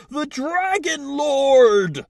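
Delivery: high, drawn-out shout of alarm; big slow high-pitched yell; calling out. Acted. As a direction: cry it out across the water, long and loud. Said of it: "One of them calls out".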